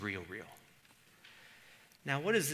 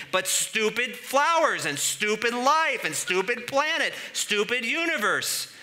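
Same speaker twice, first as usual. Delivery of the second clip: slow, high, loud bitter ranting; hammed-up cursing of the universe; bitter despair; 12 semitones higher, 10 dB louder, slower than usual. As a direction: spit out each stupid.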